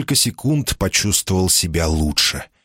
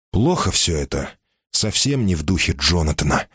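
usual speaking voice

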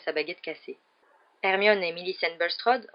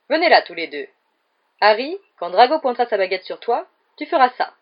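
loud, projected teacher's directions; showy instructing; 5 semitones higher, 8 dB louder than usual. A narrator's reading. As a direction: instruct them before a crowd. Lockhart instructs duellists before crowd. (consignes lancées d'une voix forte)

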